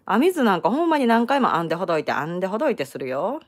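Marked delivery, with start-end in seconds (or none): none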